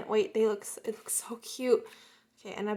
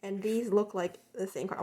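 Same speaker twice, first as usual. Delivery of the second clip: usual speaking voice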